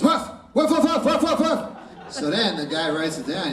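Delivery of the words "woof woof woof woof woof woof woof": high yappy woofs, much higher; high dog barks. Acted. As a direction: bark like a little dog, way up high. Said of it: "So the dog goes".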